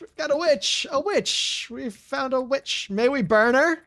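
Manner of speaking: high-pitched mob crying out; Monty Python villagers. Acted. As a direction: shriek it like a comic witch-hunting villager. Doing the Monty stuff now.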